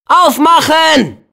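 slow, high call to open up. Acted. Calling at the castle door to be let in, slow high call. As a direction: drag it out, calling through the door.

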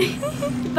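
short high-pitched laugh; high giggle. Real, not acted. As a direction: giggle at yourself. Laughing at herself shopping.

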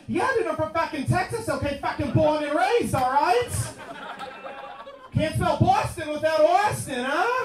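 high-pitched, pushy tough-guy impression; hammed-up Boston guy insisting he's Texan; Mark Wahlberg. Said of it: "Mark Wahlberg voice".

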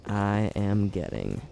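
slow, trailing off to near silence; slow fading boast. Real, not acted. Gamer mocking his own boast, slow and fading. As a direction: let "I am getting good" die away slowly.

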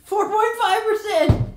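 high, slow indignant shout; shouted outrage. Real, not acted. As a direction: yell the number in disbelief. Shouting out the low percentage.

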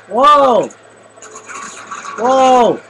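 loud high startled whoa; startled yell. Real, not acted. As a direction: yelp in real alarm. Sudden danger in game.

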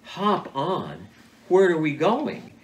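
slow, incredulous echo, then a rising question; retold bafflement. Hunter told to climb onto a deer, retold bafflement.

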